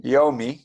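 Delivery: Yomi said with an audible y; yud sounded. Shown as a demonstration. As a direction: model "Yomi" as YOH-mee. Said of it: "do the yud at the end".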